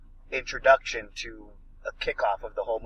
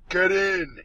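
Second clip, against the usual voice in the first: higher-pitched imitation of a movie shout; mock yell; 6 semitones higher than usual. Action hero calling from helicopter to train, mock yell. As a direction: call it out like an action hero, not full volume. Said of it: "yell from one vehicle to another".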